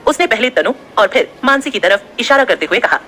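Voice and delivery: ordinary delivery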